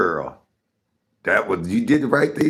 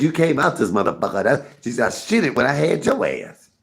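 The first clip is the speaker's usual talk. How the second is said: louder, higher mock-scolding mama; mama's brash retort; 6 semitones higher, 5 dB louder than usual. Mama answers her shocked son, brash mock scolding. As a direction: imitate mama firing back loudly. Retells mother's crude comeback.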